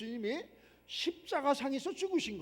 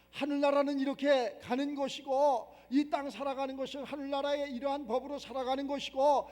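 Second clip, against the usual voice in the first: ordinary delivery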